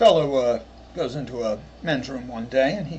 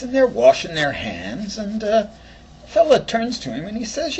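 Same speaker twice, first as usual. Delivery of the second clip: ordinary talk, nothing marked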